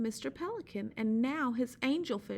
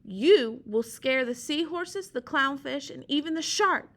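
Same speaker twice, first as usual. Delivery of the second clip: Sea Slug yelling; 4 semitones higher, 8 dB louder than usual. Acted. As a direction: beg him to go, full volume.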